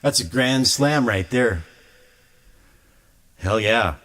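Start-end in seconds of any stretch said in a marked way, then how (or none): none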